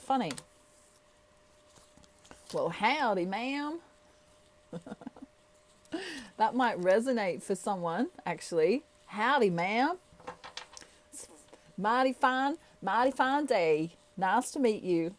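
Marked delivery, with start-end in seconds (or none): 2.5-3.8 playful Texan drawl greeting; Texan accent. Shown as a demonstration. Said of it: "I can do a Texan accent". 9.1-15.1 mock Texan howdy; Texan accent. Shown as a demonstration. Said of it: "I can do a Texan accent".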